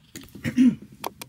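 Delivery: rough throat clearing. Real, not acted. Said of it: "The way I just coughed".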